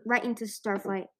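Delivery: ordinary delivery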